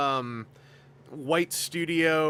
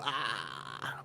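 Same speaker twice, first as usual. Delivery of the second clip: imitating sigh; 7 semitones lower, 11 dB quieter than usual. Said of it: "they go".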